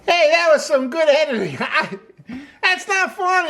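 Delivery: breaking into real laughter mid-line; can't hold back the giggles; laughing. Real, not acted. Host cracking up at his own editing joke, laughing. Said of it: "I can't keep a straight face".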